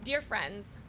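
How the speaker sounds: ordinary delivery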